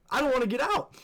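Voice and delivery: high-pitched mock-scared whine of refusal; scared reluctance. Refuses to leave car, scared.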